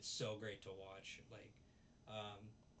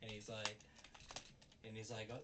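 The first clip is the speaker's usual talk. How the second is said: ordinary talk, nothing marked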